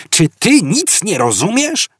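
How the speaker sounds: exasperated high-pitched cry; yelling. A narrator's reading. Arthur yells at Fort from the mud, high and exasperated. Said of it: "wrzasnął Artur".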